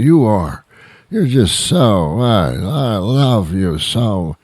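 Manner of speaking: hammed-up loving drunk, pleading for hugs; clingy nice drunk. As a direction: overdo the drunk who won't let go. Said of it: "they don't let go of you".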